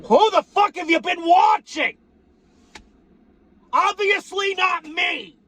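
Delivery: high, loud incredulous yell; high, slow, indignant shout; outraged shout. Real, not acted. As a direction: bellow it at the clueless viewers. Mock outrage at viewers.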